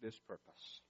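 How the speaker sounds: hushed closing prayer. Acted. Opening prayer.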